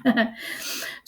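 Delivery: brief quiet chuckle; short laugh. Real, not acted. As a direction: let out a small, soft laugh. Amused at reading her own words.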